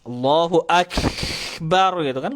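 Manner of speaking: Allahu Akbar dengan kaf ditarik lama, pelan; azan kaf kepanjangan. Shown as a demonstration. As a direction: tiru muazin yang memanjangkan kaf. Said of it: "lucu dengernya". (azan dengan kaf kelamaan)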